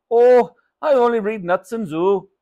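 cheery shopkeeper, high and loud; Raj. Acted. Shopkeeper tells mother what he reads, cheery.

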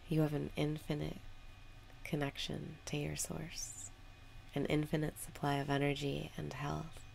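soothing breath-work guidance; guided meditation. Acted. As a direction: ease them into the moment with long pauses.

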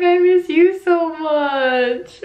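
high, drawn-out affection; retold fond longing. Retelling her fond words on call.

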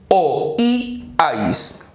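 "O, I, ais" split into separate syllables with gaps. Shown as a demonstration. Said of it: "Separamos en sílabas". ("O, I, ais" separado en sílabas)

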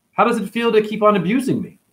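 high-pitched, pointed question to an abuser; mock questioning. Shown as a demonstration. Demonstrates reply to an abuser.

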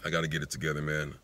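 a half-joking teary moment over sneakers; emotional. Real, not acted. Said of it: "showing that emotional moment".

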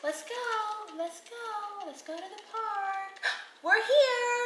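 high pretend mommy cooing. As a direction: play mommy to a baby, high and sweet. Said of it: "Okay, I'll be the mommy".

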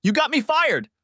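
very high-pitched outraged accusation; narrator's contained angry cry; restrained shout. A narrator's reading. Wife shouting accusations at her husband.

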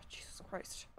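whispered curse under the breath; barely audible, voiceless muttered oath; exasperated whisper. Real, not acted. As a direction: breathe it out, fed up.

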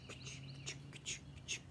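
soft unvoiced chuk chuk like footsteps; crunching footsteps whispered to the children; whispered shoe steps. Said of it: "They go chuk".

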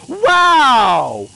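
long, loud, falling "wow"; loud exclamation. Real, not acted. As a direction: exclaim it big and drawn out. Reacting to the game.

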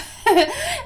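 high giggle; real high-pitched laugh; amused laugh. Real, not acted. Laughing at club idea.

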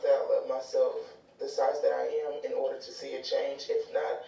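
low-pitched reflective commentary; explanatory voice-over. Real, not acted. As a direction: look back on it in a low, subdued voice.

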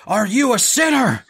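loud, high preacher demand; preacher's question. Retold or imitated. Preacher questions him at the altar, loud and high. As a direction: press him on his sins. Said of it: "And he goes".

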